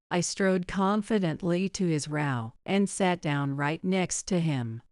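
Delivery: ordinary delivery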